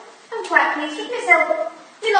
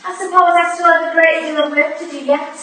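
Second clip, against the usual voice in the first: usual speaking voice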